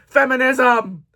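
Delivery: loud, falling cheer of "Feminism!"; mock cheer. Booktuber mock-cheers her book pick. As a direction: shout "Feminism!" like a rally cry.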